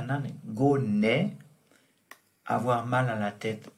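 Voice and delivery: usual speaking voice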